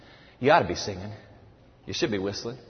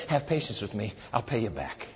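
quiet pleading; 9 semitones lower, 10 dB quieter than usual. Retold or imitated. Begging words repeated.

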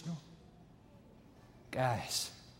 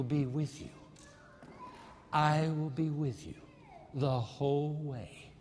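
tender voice of God; 2 semitones lower, 3 dB quieter than usual. Retold or imitated. God speaking to a grieving widow in the dark, tenderly reassuring. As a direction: comfort gently, leaving space between phrases. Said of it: "And the voice said to her".

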